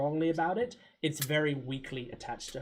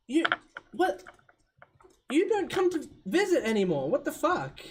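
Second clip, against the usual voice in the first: much higher and louder, outraged scolding; indignant Sasha; 13 semitones higher, 8 dB louder than usual. Acted. Sasha scolds Sigmund for not visiting, much higher indignant. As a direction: squeal it like a hurt teenager. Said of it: "And she goes".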